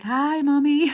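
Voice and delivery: high, bright puppy greeting; dog's sweet voice. Pretend. Owner voicing her cozy dog, a high sweet greeting. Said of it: "he's like".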